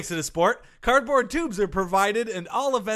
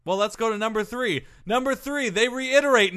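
usual speaking voice

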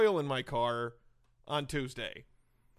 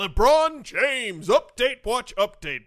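louder, slower echo of the title; announcer voice; 4 semitones higher, 8 dB louder, slower than usual. Acted. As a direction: boom out the segment name. Echoing segment title announcement.

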